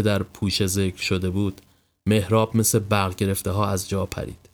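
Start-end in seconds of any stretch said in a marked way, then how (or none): none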